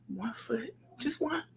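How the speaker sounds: very soft, as if coaxing herself; barely-there voice, taking it slight and careful; hushed resolve. Retold or imitated. Single mother recalling how she pushed herself on, hushed resolve. Urging herself on through tears, retold.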